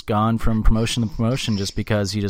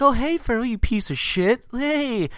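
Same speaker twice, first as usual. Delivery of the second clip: much higher, mock-sweet dog talk with insults; mean MJF taunting dog; 10 semitones higher than usual. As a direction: coo nastily at the dog, pitched up. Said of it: "He's like".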